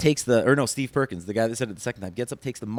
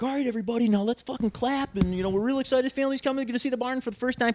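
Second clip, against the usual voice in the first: much higher, hammed-up host voice; mock hype producer; 11 semitones higher, faster than usual. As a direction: pump up the crowd like a cheesy TV host.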